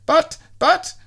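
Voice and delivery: very high flustered stammer; Mister Messy squeak. A narrator's reading. Mister Messy protests as he is bundled off, squeaky. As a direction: sputter helplessly.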